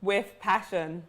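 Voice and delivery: With passion stressed slow and low; emphasized. Shown as a demonstration. Host insisting he compliment the rival, slow stress. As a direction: lean on "With passion", slow and deliberate. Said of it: "I'm gonna emphasize that".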